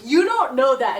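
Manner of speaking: mock retort. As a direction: snap it back at him.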